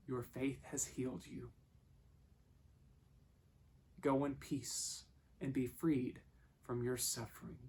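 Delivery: soft words with long pauses; hushed, measured blessing; gentle reassurance. Retold or imitated. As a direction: speak tenderly, letting each phrase settle. Jesus tenderly reassures the healed woman.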